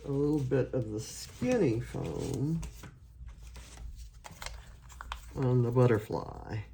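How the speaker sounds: talking with a pin in mouth. Real, not acted. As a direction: say it around a pin held in lips. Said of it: "I'm speaking with a pin in my mouth".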